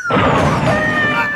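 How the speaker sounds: a sudden high, loud yelp of disbelief; incredulous outburst. Real, not acted. Podcaster reacting to the whistle sound effect, loud incredulous yelp. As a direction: shriek it like you can't believe it.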